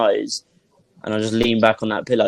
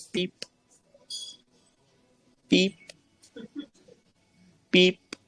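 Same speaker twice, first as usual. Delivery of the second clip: soft, high machine beep; imitated beep; 7 semitones higher, 9 dB quieter, much slower than usual. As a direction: mimic a distant monitor beeping. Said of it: "I could hear a beep".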